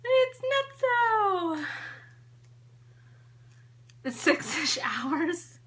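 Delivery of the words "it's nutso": slow and much higher, playful; high and drawn out. Pretend. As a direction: stretch it out up high. Playful exclaim.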